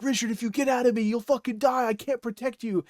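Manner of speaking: high, fast, urgent alien voice; a living suit warning Richard not to leave; pleading symbiote suit. Retold or imitated. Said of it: "the suit is like".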